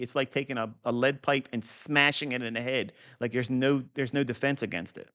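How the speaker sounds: ordinary talk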